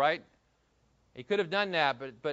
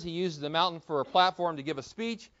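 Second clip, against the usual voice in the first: normal talking voice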